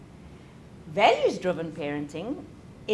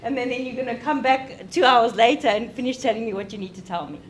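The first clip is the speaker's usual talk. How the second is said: louder, higher, fed-up rant at a friend; exasperated complaint; 4 semitones higher, 5 dB louder than usual. Retold or imitated. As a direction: vent your impatience, words tumbling out. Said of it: "I'm like".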